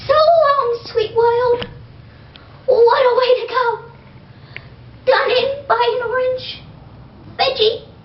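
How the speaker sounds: high, hammed-up last words; dying tooth's farewell. Tooth bids farewell after the crunch, hammy and high. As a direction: melodramatic final goodbye.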